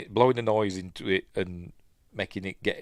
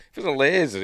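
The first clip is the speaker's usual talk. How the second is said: ordinary delivery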